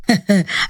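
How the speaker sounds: low, short laugh; brief teasing laugh in a man's role; amused chuckle. Acted. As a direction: chuckle at her fieriness. Protector amused by her fiery threat.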